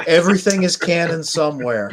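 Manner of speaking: big, loud movie-trailer line; dramatic tagline. Pretend. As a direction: announce it like a trailer.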